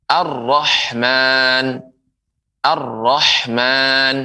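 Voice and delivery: "ar-Rahman" very slowly with held rolled r; slow model of "ar-Rahman", no l; lam merged into ra. Shown as a demonstration. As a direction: stretch "ar-Rahman", doubled r and long final a. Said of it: "Nah, begitu".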